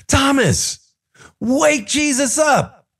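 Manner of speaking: high, drawn-out mock panic; acted urgent plea. Disciple begging Thomas to wake Jesus, panicked. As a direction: urge it high and slow, acting frightened.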